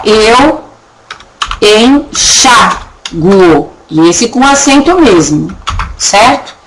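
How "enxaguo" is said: "enxaguo" very slowly, stressed U; slow demo. Shown as a demonstration. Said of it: "Ficaria lá".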